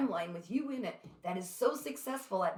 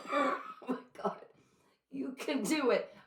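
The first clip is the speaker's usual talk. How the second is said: choked up; 6 semitones higher than usual. Real, not acted. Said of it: "I'm getting choked up".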